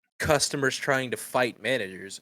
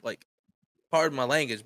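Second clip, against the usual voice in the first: ordinary delivery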